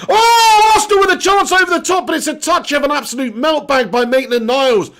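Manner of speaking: very high, loud cry sliding down as the chance goes; excited shouted play-by-play. Real, not acted. Commentator watching Arsenal waste a chance over the top, excited shouted play-by-play. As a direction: erupt at the chance, then deflate.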